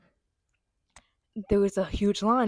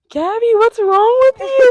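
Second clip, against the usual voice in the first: high, loud, slow scolding; mock crowd yell; 16 dB louder, slower than usual. Everyone in the bathroom yelling at Gabby, loud.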